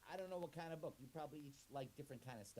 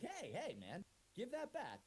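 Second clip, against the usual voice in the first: higher, slow give-that-back protest; upset protest; 11 semitones higher, 3 dB louder, slower than usual. Acted. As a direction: object as the book is taken. Book snatched away.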